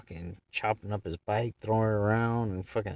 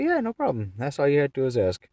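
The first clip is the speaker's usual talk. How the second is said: mock sweet reply; 7 semitones higher, 6 dB louder, faster than usual. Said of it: "I said".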